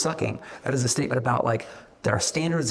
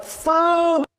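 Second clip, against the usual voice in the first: squeaked high and loud, drawn out; a high loud cartoon "Food."; high mock answer; 6 dB louder than usual. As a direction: play the answer as a comic squeak. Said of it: "I'm like".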